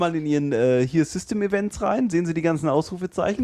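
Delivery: high-pitched phone scammer patter; mock tech-support scammer, pitched well up; scam caller. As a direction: play the bogus helpdesk caller in a high voice. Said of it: "die sagen dann".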